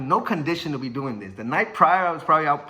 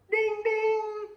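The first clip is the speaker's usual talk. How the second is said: very high ringing "ding, ding"; imitated bell ding. Storyteller voices the opening bell, high imitated ding. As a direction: sing out the bell in a high pitch.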